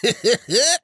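high burst of guffawing. Acted. Preacher laughing at Turtle Dove's explanation, high guffaw. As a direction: let out a high, quick guffaw. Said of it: "Preacher guffawed".

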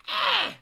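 a sharp high yelp when kicked; high pained yelp. Acted. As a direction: yelp of being punted. Little man gets kicked.

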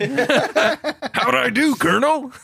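high, slow, laughing recruit; eager recruit voice. Acted. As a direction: ask proudly like a clueless recruit.

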